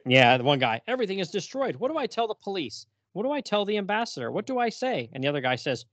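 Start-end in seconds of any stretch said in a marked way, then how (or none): 0.9-5.1 higher, fretting mock panic; panicky diplomat. Diplomat fretting to his colleague after the blast, higher mock panic. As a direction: wring your hands over what to tell them.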